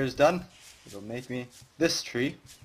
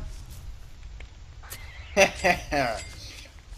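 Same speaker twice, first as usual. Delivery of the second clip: breathy giggle; laughing; 3 semitones higher than usual. Real, not acted. Laughs after chase.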